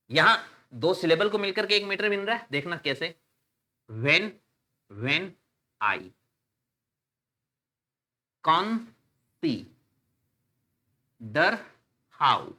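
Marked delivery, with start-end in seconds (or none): 3.9-12.5 "when I con-si-der how" very slow, a long pause after each syllable. Shown as a demonstration. Teacher counting syllables for students, "when I consider how" broken syllable by syllable. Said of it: "एक syllable, एक syllable".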